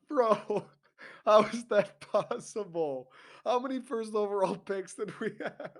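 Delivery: high, laughing disbelief; can't believe it, laughing through the words. Real, not acted. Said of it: "incredulous laughing rant".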